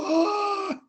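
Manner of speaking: high, loud mock-tearful "oh"; retold tearful outburst. Seeing the couple on a video call, re-enacted tearful cry. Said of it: "I'm crying already".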